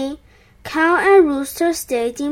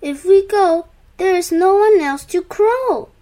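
high, loud worried objection; exclaiming; 5 semitones higher, 4 dB louder than usual. A narrator's reading. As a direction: exclaim it as a protest. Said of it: "they exclaimed".

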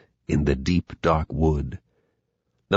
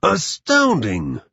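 high, slow amazement; astounded Gruffalo; 7 semitones higher, slower than usual. Acted. Gruffalo sees Owl flee, high slow astonishment. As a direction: stretch it out in monstrous wonder.

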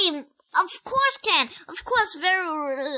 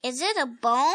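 ordinary delivery, nothing marked

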